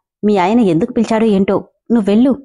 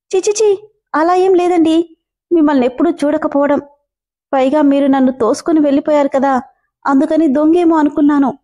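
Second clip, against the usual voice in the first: put-on higher, pleading young woman, tearful; frantic denial; 4 semitones higher than usual. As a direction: rush the denial in a high timid voice. Manu protests in tears. (ఏడుస్తూ కంగారుగా సంజాయిషీ)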